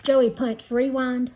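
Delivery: usual speaking voice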